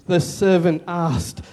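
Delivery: normal talking voice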